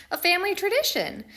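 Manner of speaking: ordinary delivery, nothing marked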